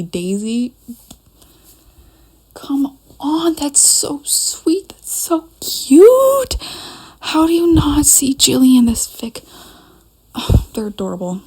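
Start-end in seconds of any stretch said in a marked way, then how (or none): 2.5-9.1 high breathy whispered gush; gushing squeal. Real, not acted. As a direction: squeal it, too cute to bear. Gushing over a fanfic couple.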